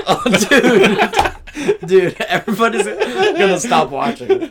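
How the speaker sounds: talking through laughter; laughing. Real, not acted. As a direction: laugh out the words. Laughing at friend's burps.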